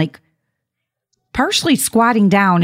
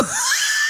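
a shrieking laugh, much higher; high burst of real laughter; 5 dB quieter than usual. Real, not acted. Laughing at what she told the scolding shopper, shrieking. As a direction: crack up at her own comeback. Laughs at her own line.

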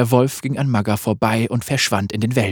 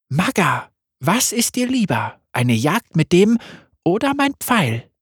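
deutlich höher, sanft und verspielt; helle Lamm-Stimme; 7 semitones higher than usual. Acted. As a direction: ein unheimlich freundliches Lamm. Lam voice again. (Lamm stellt sich vor, hoch und sanft)

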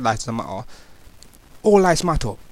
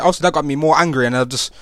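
normal talking voice

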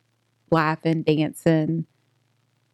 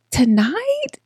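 surprised question; 10 semitones higher than usual. Retold or imitated. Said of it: "I said".

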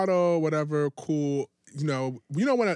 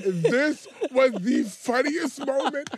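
laughing; 6 semitones higher, 4 dB louder, much slower than usual. Real, not acted. Laughing at memory.

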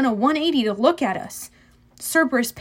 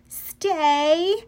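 drawn-out, higher command; ordered; 5 semitones higher than usual. A narrator's reading. Annabeth orders the monster to stay, commanding. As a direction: hold a dog in place with one long word. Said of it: "Annabeth ordered the monster".

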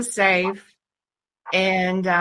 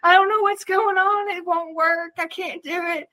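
high, fretful mock husband; husband panicking; 9 semitones higher than usual. Husband failing with swingers, mock panicked whine. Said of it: "my husband's over there going".

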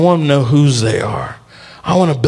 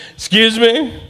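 high, rising mock-puzzled query; baffled Gideon; 5 semitones higher than usual. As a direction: query it high, in comic disbelief. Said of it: "He's like".

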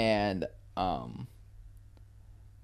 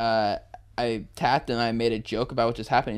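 ordinary delivery

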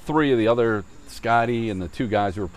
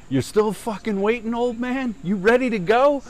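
mock taunting holler; 11 semitones higher than usual. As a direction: call out a fight challenge in a pitched-up voice. Overheard challenge acted out.

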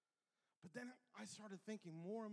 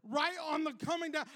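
usual speaking voice

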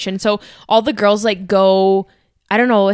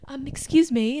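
higher, softer attempt at British; British accent; 6 semitones higher, 5 dB quieter than usual. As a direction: lightly imitate a polite British dad. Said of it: "sorry for my accent".

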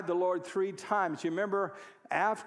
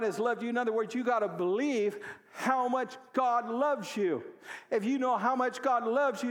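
a pastor urging believers at a raised, fervent pitch; preaching; 6 semitones higher than usual. Real, not acted. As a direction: press it on the congregation with fervor. Preaching builds again.

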